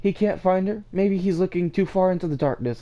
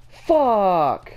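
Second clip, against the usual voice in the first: sharp loud curse at the screen; a real burst of gamer rage; frustrated yell; 6 semitones higher, 4 dB louder than usual. Real, not acted. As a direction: blurt it out in frustration. Gamer swears at dying.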